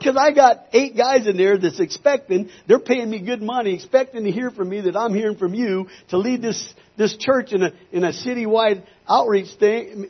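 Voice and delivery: high-pitched, urgent complaint; desperate pleading. Retold or imitated. Minister with nothing for the board, retold desperate plea to God. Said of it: "I'm getting desperate".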